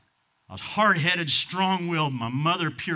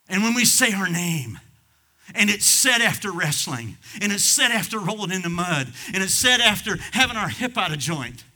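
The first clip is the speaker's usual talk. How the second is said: preaching build; 5 semitones higher, 5 dB louder than usual. Acted. Preacher building the confession to the congregation, raised. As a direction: build the rhythm.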